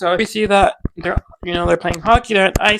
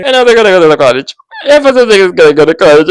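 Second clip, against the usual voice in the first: excited burst; 10 dB louder, faster than usual. Real, not acted. Thrilled about next episode.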